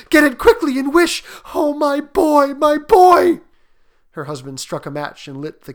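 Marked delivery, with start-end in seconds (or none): 0.1-3.4 high, loud, desperate wail; anguished, frenzied mother's cry; panting urgency. A narrator's reading. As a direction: beg frantically for a lost son. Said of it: "she panted".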